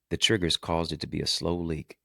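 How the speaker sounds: ordinary talk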